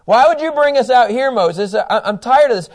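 high, loud acted griping; fed-up complaint. Preacher voicing Israelites blaming Moses, fed-up complaint. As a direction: burst out as if fed up.

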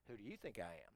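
low, hushed comeback; evasive retort. Acted. John dodging the questioners, evasive and quiet.

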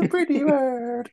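very high, slow parrot squawk. Pretend. Parrot voice repeated.

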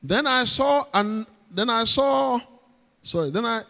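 normal talking voice

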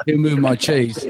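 laughing, imitating a Cockney accent; mock Cockney accent. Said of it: "or your accent".

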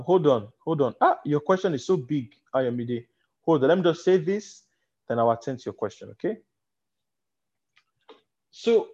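ordinary talk

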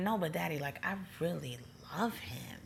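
soft, whispery imitation of crying; retold tearful plea. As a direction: plead softly, as if through tears. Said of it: "And then I'm crying".

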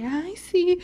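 high, sweet customer service "Ay, sí". Retold or imitated. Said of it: "con mi voz del servicio al cliente".

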